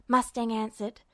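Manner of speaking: usual speaking voice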